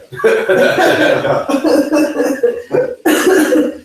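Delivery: long high airy laughter; long breathy laugh. Real, not acted. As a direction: keep laughing helplessly. Laughing at own joke.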